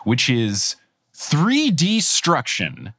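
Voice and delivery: three D struction spelled out slowly, leaning on three; drawn-out pun title. Real, not acted. Host unveils the punny episode title, slow and savoring. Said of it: "every single one is a pun".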